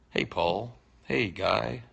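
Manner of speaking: ordinary delivery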